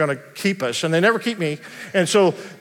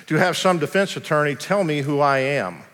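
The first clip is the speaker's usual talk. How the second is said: usual speaking voice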